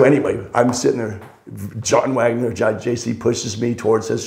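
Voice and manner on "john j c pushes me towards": ordinary delivery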